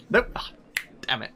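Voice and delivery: ordinary delivery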